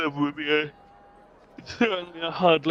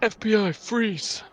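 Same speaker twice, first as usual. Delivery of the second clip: higher-pitched mock police call; mock FBI agent; 9 semitones higher than usual. Player joking about Blossom's arrest, mock FBI agent.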